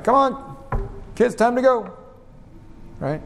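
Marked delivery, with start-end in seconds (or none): 0.0-1.9 put-on high, coaxing call to children. Shown as a demonstration. Parent calling kids that it's time to go, calling. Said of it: "difference between a call and a command".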